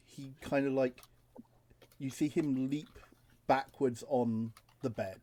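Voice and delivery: ordinary delivery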